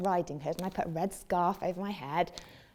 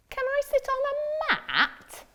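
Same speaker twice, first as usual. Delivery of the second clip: frog voice; faster than usual. Acted. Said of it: "asked the frog".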